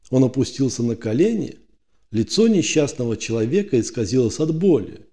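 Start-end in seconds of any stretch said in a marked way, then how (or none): none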